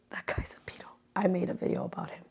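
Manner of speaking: fast hushed aside; whispered aside. Real, not acted. Telling viewers about the pedo realtor, whispered. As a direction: whisper it quickly.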